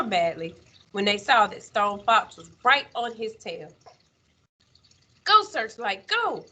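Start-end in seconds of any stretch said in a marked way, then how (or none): 5.2-6.4 high, urgent cheer to a racing dog; eager cry without much extra volume. A narrator's reading. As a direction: spur the dog on, voice leaping up. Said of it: "little Willie cried out".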